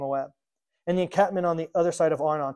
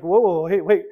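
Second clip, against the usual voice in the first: calling them out; 6 dB louder than usual. Retold or imitated. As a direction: halt them with a loud, imitated objection. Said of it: "he's calling them out".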